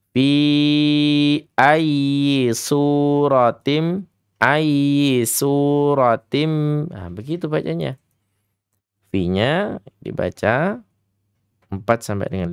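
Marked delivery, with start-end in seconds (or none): 0.1-6.5 slow, drawn-out chanted recitation; Quran recitation. Retold or imitated. Teacher imitating how students recite, slow chant. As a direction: chant each word long and rising like students reciting. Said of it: "mereka biasanya gini".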